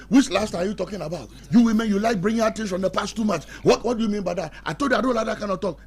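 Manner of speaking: high, loud, defensive husband snapping back; heated retort. Retold or imitated. Husband arguing with his wife over the soap, high and loud. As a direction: fire it back like a cornered husband. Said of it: "Then the man said".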